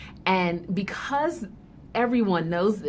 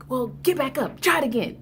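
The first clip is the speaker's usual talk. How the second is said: brisk parental urging; 6 semitones higher, 4 dB louder, faster than usual. Acted. Parent urging fallen child back up.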